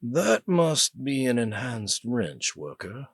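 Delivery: put-on slow, measured British accent; a slow, musing British officer; the older British prisoner sizing up the worker; British older man. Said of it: "He likewise had an accent that seemed British".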